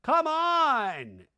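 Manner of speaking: high, loud exasperated shout; hammed-up pleading host; [name]. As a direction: yell over a squabbling crowd. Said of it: "[name] does his natural".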